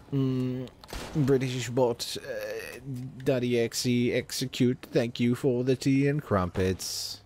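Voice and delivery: slow, stilted British bot; mock a British robot reciting its commands. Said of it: "not just a robot who's like".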